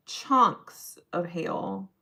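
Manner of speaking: ordinary delivery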